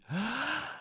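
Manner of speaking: soft gasp in, then voiceless staccato puffs out; laughter pattern on breath alone, ending in a long exhale; silent laughter breathing. Shown as a demonstration. Teacher shows breathing without the voice, silent laughter pattern. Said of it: "just to do it silently".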